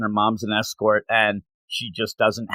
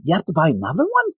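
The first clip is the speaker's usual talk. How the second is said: puzzled Tecopi; 11 semitones higher than usual. Retold or imitated. Tecopi voiced, confused by lost mats.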